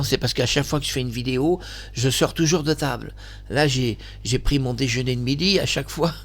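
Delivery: ordinary talk, nothing marked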